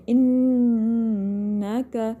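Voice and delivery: انکا with a long nasal hum on the noon; nasal ghunna. Shown as a demonstration. Teacher models ghunna for students, humming the noon in انکا. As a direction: hold the doubled n of انکا in the nose, very slowly. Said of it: "اچھے سے ناک میں آواز لے کے جانی ہے".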